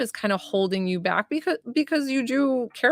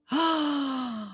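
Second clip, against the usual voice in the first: quick surprised gasp of delight; breathy little intake of surprise; sharp gasp; 6 semitones higher, 5 dB quieter than usual. Real, not acted. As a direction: gasp as if hearing great news. Reacting to a reading.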